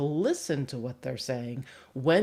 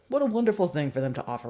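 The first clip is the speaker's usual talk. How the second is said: usual speaking voice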